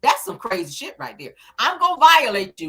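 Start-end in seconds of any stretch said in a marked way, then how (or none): 1.6-2.7 louder, higher voice of a threatening exploiter; mock threat. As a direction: spell out the threat loudly, imitating the abuser. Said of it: "This is what it's saying".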